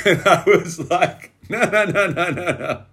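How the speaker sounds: high-pitched laugh through the words; amused, laughing protest; laughing while retelling. Real, not acted. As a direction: tell it through a laugh, voice riding up. Laughing as he retells his reaction.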